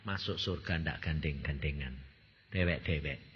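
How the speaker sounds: God's voice. Retold or imitated. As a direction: answer gently but firmly, as God. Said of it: "Terus Tuhan bilang". (suara Tuhan, rendah dan tenang)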